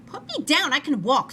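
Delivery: put-on high, loud protest from a little girl; child screaming. A narrator's reading. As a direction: shout it like an upset child. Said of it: "screamed Jane".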